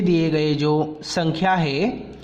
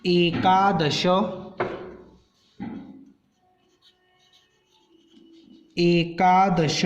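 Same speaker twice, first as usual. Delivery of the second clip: slow dictation; much slower than usual. Shown as a demonstration. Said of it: "teacher dictating number words".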